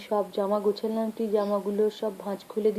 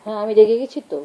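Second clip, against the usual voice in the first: mock angry, much louder; 11 dB louder, faster than usual. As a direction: bark it out, playfully cross with the child. Pretend anger with a child. (বাচ্চার সঙ্গে খেলায় জোরে রাগের ভান)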